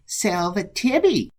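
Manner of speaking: high, drawn-out pious greeting; mocking mimicry. Monk apes Brother Lawrence at the meal, high mocking voice.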